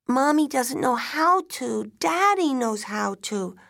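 put-on high, loud little-kid protest; child protesting. A narrator's reading. Daughter protesting mother can't make pancakes, upset child. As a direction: object like an upset small child.

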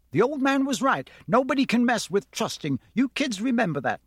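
a hint of know-it-all big brother; lecturing older boy. A narrator's reading. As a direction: lay down the law to the little kids.

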